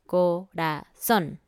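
"Corazón" slow, syllable by syllable, landing on -zón; stress on final syllable. Shown as a demonstration. Teacher illustrating the tilde rule, slow "Corazón" stressed on the end. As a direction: spell out "Corazón" in beats, co-ra-ZÓN. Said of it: "lleva una tilde sobre la O".

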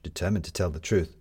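plain reading voice

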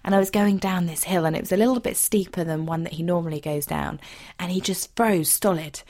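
ordinary delivery

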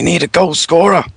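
a hint of a strained gruff voice; lightly voiced mock desperation; put-on voice. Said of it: "he needed that voice".

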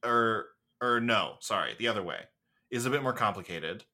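ordinary delivery

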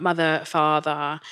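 normal talking voice

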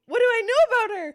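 loud, high teary squeal; overjoyed cry. Real, not acted. Birthday girl asks about her elephant, teary and loud. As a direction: cry out with joy.

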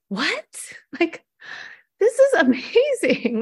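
high, laughing astonishment; delighted disbelief. Retold or imitated. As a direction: gasp it out like you can't believe it. Reacting to girl buying K-pop.

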